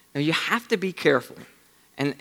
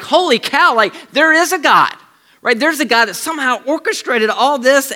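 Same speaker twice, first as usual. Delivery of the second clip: high, excited disbelief; imagined awestruck woman, much higher and louder; amazed realization; 9 semitones higher, 7 dB louder than usual. Retold or imitated. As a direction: gasp it out like you just found God.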